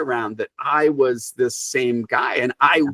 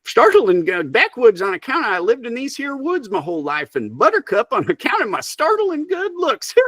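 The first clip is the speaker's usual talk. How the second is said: high-pitched Southern backwoods character voice; Backwoods Buttercup; 11 semitones higher than usual. Acted. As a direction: play the wild woods hermit with a Southern twang, pitched way up. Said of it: "I don't know why he has a Southern accent".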